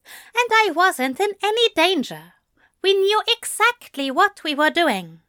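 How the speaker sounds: high-pitched, defensive insistence; protesting reassurance. A narrator's reading. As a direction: insist there was nothing to fear. Angel protests against demon's worry.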